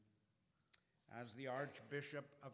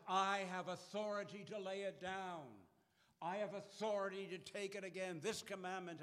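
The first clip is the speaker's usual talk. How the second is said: loud, raised voice declaring authority; ringing proclamation; 6 semitones higher, 7 dB louder than usual. Acted. Preacher voicing Jesus to the congregation, loud and ringing. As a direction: proclaim it to the back pews.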